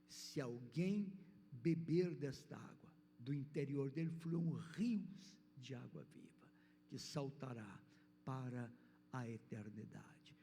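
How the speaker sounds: fervent pulpit reading; preaching. Real, not acted. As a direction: proclaim the verses with full conviction, slowing at the end. Preaching a scripture passage.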